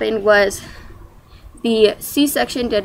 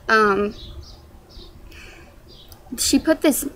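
usual speaking voice

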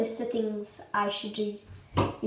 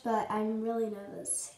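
ordinary talk

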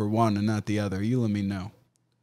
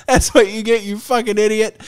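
mocking jeer; 7 semitones higher, 4 dB louder than usual. Mocking commenters quoted.

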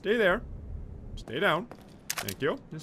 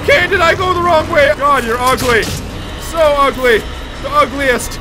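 loud taunt; 6 semitones higher, 6 dB louder than usual. Real, not acted. Taunting ugly monster.

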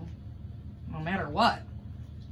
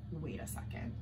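whisper; 7 semitones lower, 16 dB quieter than usual. Acted. Suspects a trick.

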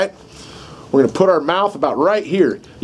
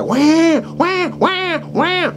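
put-on much higher, frantic baby wailing; mock baby wail; 13 semitones higher, much slower than usual. Shown as a demonstration. Instructor shows a badly distressed baby crying. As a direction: wail like a really upset baby. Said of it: "they cry really bad".

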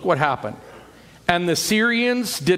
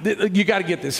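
ordinary delivery

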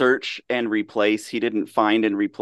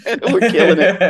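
New Jersey-free, laughing boast, higher and louder; laughing brag; 8 semitones higher, 7 dB louder than usual. Real, not acted. Co-host laughing off mistakes to the host, laughing proudly. Joking about their many mistakes.

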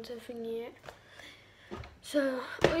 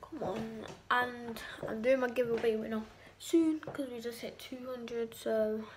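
ordinary talk

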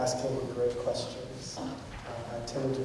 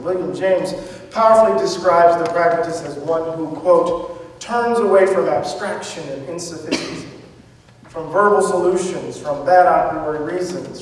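loud, projected reading of a prepared paper; a philosopher reading his paper at full projection; formal lecture reading; 3 semitones higher, 9 dB louder than usual. Acted. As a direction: deliver the text like a formal address to the hall. Reads prepared lecture text aloud.

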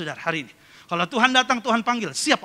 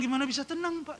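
high, softer mock-anxious plea; imitated frightened woman; 6 semitones higher, 5 dB quieter than usual. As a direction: mimic a worried woman, pitched up. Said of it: "Mukanya takut". (tiruan ibu cemas bernada tinggi)

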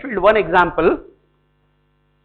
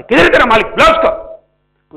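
angry customer; 5 semitones higher, 8 dB louder than usual. Acted. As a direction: shout for the owner over bad tea. Customer complains about kerosene tea.

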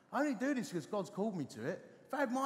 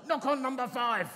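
loud, higher imitated command; grown-loud inner voice; 5 semitones higher, 6 dB louder than usual. Inner voice now insists to the vicar, loud command. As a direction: blare out the insistent inner prompting. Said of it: "grown to a sound that's like".

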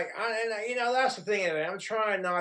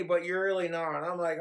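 ordinary delivery

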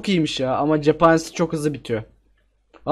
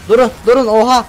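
sudden loud, higher cry of alarm; startled shout; 5 semitones higher, 5 dB louder than usual. Real, not acted. Gamer startled by gunmen, cries out in alarm. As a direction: caught off guard by a crowd of enemies. Ambushed by ten gunmen in game.

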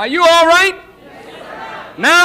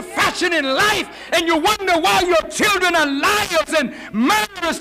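impassioned preaching; 4 semitones higher than usual. Real, not acted. Preacher accusing the woman over lying and murdering, impassioned preaching. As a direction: hammer the accusation home to the congregation.

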